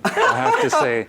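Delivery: laughing through the words. Real, not acted. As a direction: break into a laugh as you speak. Laughs at own quip.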